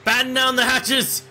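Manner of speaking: high, drawn-out shipboard call; mock yell. Crew member heading up to the ship, yelling an order. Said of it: "and start yelling".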